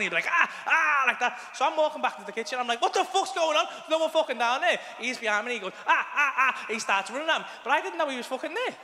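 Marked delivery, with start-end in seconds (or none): 0.3-1.0 high, excited yelps; excited child's shouts. Retold or imitated. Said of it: "he starts shouting". 2.8-4.8 high-pitched, acted bewilderment; bewildered outburst. Acting out his bewildered reaction. 5.9-6.7 high, repeated yelps; excited child's shouts. Retold or imitated. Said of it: "he starts shouting".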